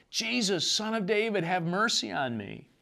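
high pleading cry, retold not shouted. Blind beggar calling out to Jesus, high pleading cry. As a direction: plead for mercy, pitched high. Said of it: "he starts hollering out".

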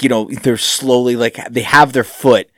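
ordinary delivery

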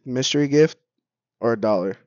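loud, hyped streamer catchphrase; lightly imitating a YouTube streamer; Korean NPC impression. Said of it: "You sound like the Korean N P C".

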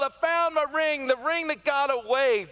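high, loud imitated delight; overjoyed. Imitating mother celebrating her found ring, high and loud. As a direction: sing it out like a winner. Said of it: "mother's joyful quote".

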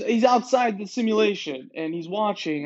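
normal talking voice

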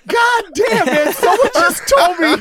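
ramp up with growing excitement; building to the punchline, thrilled; excited. Real, not acted. Story climax, excited high voice.

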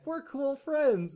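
drawn-out high voice for the eager little robot; Tailgate voice. Retold or imitated. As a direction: play Tailgate beaming with friendly glee. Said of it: "Tailgate being like".

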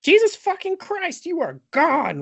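very high, loud-ish mock freak-out; hammed-up hysterical disbelief; losing his mind. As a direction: squeal it as if totally flipping out. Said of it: "Like, he loses his mind".

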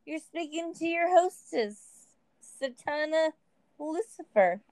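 lightly voiced sultry hostess; hotline hostess. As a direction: announce it like a hotline host. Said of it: "This is the murder hotline".

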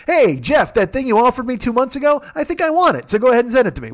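high-pitched, pushy mock request; hammed-up entitled demand; obnoxious request. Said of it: "like a dick".

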